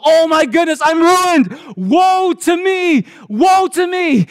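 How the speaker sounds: the prophet shrieking in terror before the holy God; frantic cry of woe. Acted. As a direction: scream out in panic at being ruined. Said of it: "Isaiah ... going".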